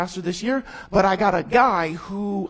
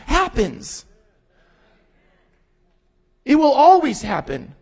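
full-force pulpit declaration; preaching; 5 semitones higher, 6 dB louder than usual. Real, not acted. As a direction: proclaim it to the congregation. Preaching God's promise.

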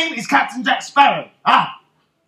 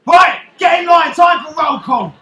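loud, raised command; mustering order; 3 semitones higher, 5 dB louder than usual. Acted. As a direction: call the crew to line up. Captain musters the crew.

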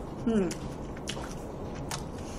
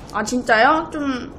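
ordinary delivery

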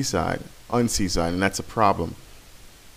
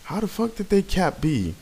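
high, squeaky outraged question; mock outrage; 9 semitones higher, 3 dB quieter than usual. Gamer mimicking his own outrage when the enemy caps B. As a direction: act out disbelief up high. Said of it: "And I'm like".

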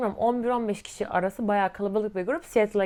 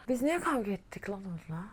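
musing to self; 2 semitones lower, 11 dB quieter than usual. Real, not acted. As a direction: drop to a soft murmur while searching your memory. Trying to recall the fight.

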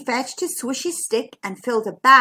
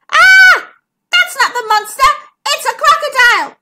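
high, loud storybook scream; panicked cry; 13 semitones higher, 11 dB louder than usual. A narrator's reading. Zara spots a crocodile in the creek, crying out in panic. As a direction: shriek it out in alarm. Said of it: "cried Zara".

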